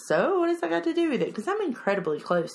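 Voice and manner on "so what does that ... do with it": high, fast, indignant; indignant kid. Retold or imitated. Daughter defending her bond with mom, quick and indignant. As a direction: fire it back like an offended teen. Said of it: "And she's like".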